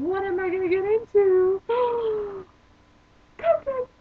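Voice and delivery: loud, high wail with a gasp; hammed-up dread, shooting up high and loud; mock panic. Joking about her new school, mock panic. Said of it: "I'm kidding".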